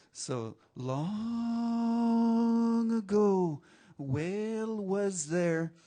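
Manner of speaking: slow, stretched-out storyteller voice; chant-like, unhurried tale telling; oral storytelling. A narrator's reading. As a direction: draw out key words, rising high and full. Tells whale story to audience.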